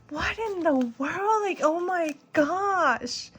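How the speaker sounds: high, stretched-out wonder; retold amazement. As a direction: relive the astonishment, drawing out each word. Retold amazement at interview call.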